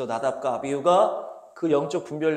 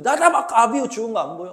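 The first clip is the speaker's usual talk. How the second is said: loud, raised-pitch scolding; angry rebuke; 7 semitones higher, 7 dB louder than usual. Acted. Moses warning the sons after two deaths, angry rebuke. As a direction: hurl the question at them as a reproach. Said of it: "막 하는 거야".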